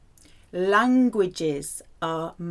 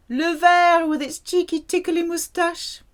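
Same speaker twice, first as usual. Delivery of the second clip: higher, playful and cheeky; 9 semitones higher, 4 dB louder than usual. Pretend. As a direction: tease it like a silly joke. Cheeky memory image.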